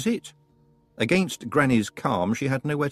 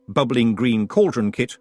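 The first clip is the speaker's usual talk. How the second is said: ordinary delivery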